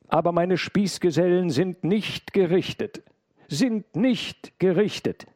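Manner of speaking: high, loud insistence; heated protest. A narrator's reading. Intruder protests to the maid about his accomplices, heated. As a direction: insist hotly, voice pitched far up.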